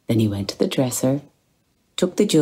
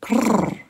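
high rolled purring; cat purr; 11 semitones higher than usual. Acted. The cat stalking round the table, a high purr.

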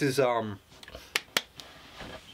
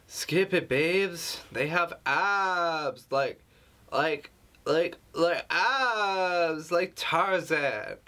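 much higher, hammed-up party-girl voice; squealing mock girlfriend; mock girly friend voice; 5 semitones higher, much slower than usual. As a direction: parody a gushing girlfriend texting. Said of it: "is this really how women talk over the phone".

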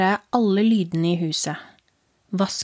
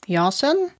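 a raised, slightly off father voice; wrong-sounding father voice; 6 semitones higher than usual. Acted. Possessed father answers his frightened son, eerie voice. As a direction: make dad sound not quite right. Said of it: "Stemmen hans var også helt feil".

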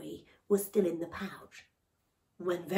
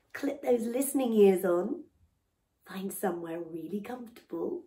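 ordinary delivery, nothing marked

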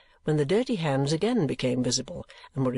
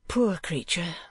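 put-on higher, gentle young woman; a pitying young lady; lightly raised young woman's voice; Kate's voice; 4 semitones higher, slower than usual. Kate pities.

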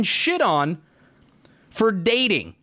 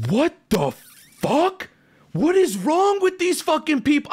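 higher, stunned angry outburst; outraged disbelief; 9 semitones higher than usual. Retold or imitated. Reacting to kids hiding mom's dating, high outraged outburst. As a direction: spit it out in disbelief.

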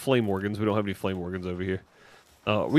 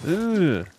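disgusted ugh gag; gagging; 8 semitones higher, 4 dB louder than usual. Real, not acted. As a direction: retch in disgust. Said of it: "this is so bad".

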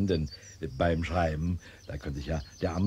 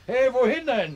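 calling after him; 7 dB louder than usual. Acted. Calling after departing guest.

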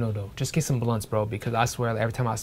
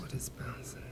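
puzzled whisper under the breath; whispered question; 13 dB quieter than usual. Acted. Applicant whispering, lost about what bouncing means.

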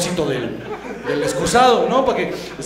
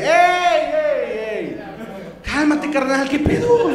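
God stopping him; 7 semitones higher, much slower than usual. Acted. God stops Abraham's knife, high and alarmed. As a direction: shout to stop the knife mid-swing. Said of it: "sale Dios y le dice".

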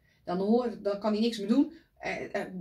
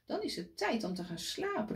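ordinary delivery

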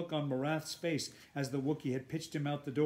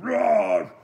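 huge loud roar; Wookiee roar; 9 semitones higher, 16 dB louder than usual. A narrator's reading. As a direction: roar it out like a beast. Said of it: "tossed its head back and roared".

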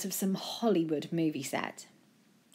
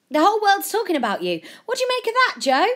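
high, loud, urgent question; reporter calling out; 9 dB louder, faster than usual. A narrator's reading. Reporter pressing Joe in a crowd, calling out high and loud. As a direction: fire it loudly over a crowd.

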